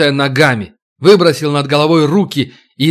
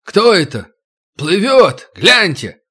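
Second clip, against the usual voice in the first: distant shout; 5 semitones higher, slower than usual. A narrator's reading. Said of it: "оттуда донеслось".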